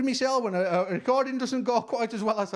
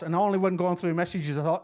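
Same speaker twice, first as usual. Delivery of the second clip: usual speaking voice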